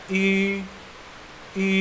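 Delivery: "I" said through lips pursed as for U; rounded ü sound. Shown as a demonstration. Teacher demonstrating the dotted U, long rounded "I". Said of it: "gabungan antara huruf U dan I".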